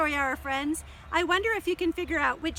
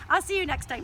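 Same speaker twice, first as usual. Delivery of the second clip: usual speaking voice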